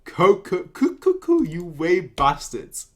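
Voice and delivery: ordinary delivery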